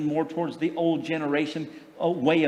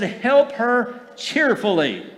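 slow and louder, with HELP leaned on hard; preacher drilling home "help her cheerfully" slowly and louder, leaning on HELP; emphatic slow call; 6 semitones higher, 5 dB louder, much slower than usual. Shown as a demonstration. To the congregation, a slow, louder "help her cheerfully" with HELP punched. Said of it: "I said".

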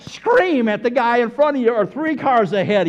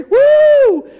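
very high, loud whoop; demonstrated cheer; 10 dB louder than usual. Shown as a demonstration. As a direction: whoop it like a sports fan. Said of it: "what kind of word is woo".

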